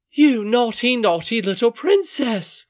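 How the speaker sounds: loud, high-pitched angry nurse; scolding cry. Acted. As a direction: scold a child at full voice. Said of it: "cried Ludi".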